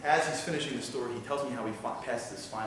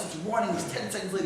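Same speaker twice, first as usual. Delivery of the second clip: ordinary delivery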